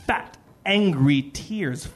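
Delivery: ordinary delivery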